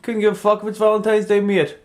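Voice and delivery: high, fast mock Caribbean accent. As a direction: toss it off in a put-on Caribbean accent. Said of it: "with that accent".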